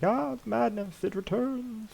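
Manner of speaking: high-pitched mock cry of madness; mock shouting. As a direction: wail it in a put-on high voice, without real volume. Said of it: "Just, like, start shouting".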